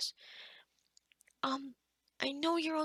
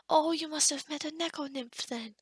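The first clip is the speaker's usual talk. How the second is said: ordinary talk, nothing marked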